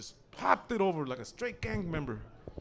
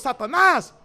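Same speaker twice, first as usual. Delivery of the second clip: very loud, high-pitched call; shouted summons; 6 semitones higher, 12 dB louder than usual. Acted. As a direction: holler the dog's name like a command. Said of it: "And he goes".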